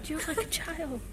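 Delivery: exasperated whisper. Real, not acted. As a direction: breathe it out in a whisper. Whispering in cinema.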